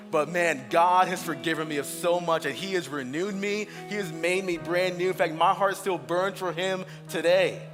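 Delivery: usual speaking voice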